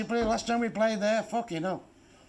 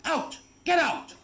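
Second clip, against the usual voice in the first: yelling out; 8 semitones higher, slower than usual. Acted. Boss yelling at applicant.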